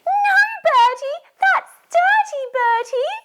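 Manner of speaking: very high, loud big-sister shout; Susie. Acted. Big sister telling Bertie off over worms, shouting. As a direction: shriek it like a cross big sister. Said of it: "Can you do it in Susie's voice".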